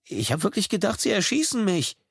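put-on high, trembling young voice; delivery boy voicing his fear; shaken teen. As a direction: still shocked, near tears. Teen after near shooting.

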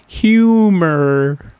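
long, playful "Humor"; drawn-out comic echo. Pretend. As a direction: draw out "Humor" in your normal register. Echoing own word for comic effect.

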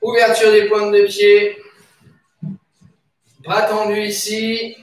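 usual speaking voice

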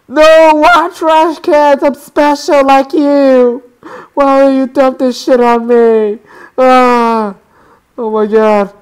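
loud, high, drawn-out protest; anguished wailing. Real, not acted. Player buried in dumped trash in a game, wailing loudly. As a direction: wail in overblown distress.

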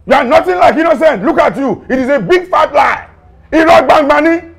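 loud, high, forceful scolding; angry accusation. Acted. Officer accusing suspect of killing, angry shouting.

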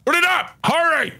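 barking command. Acted. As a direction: scream it like a stick-up. Robbery at gunpoint.

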